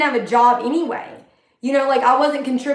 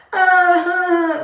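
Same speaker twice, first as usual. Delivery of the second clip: high mock sob of a crying girl; mock blubbering girl; imitating crying; 6 semitones higher, 3 dB louder than usual. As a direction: fake a weepy uh-huh, pitched up. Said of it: "like, crying".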